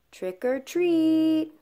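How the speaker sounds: high, drawn-out singsong call; trick-or-treat call. Acted. Trick-or-treater calling at the door, high drawn-out call. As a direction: sing it out slowly at the door.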